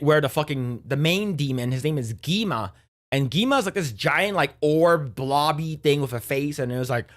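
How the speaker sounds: usual speaking voice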